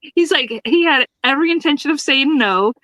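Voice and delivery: ordinary talk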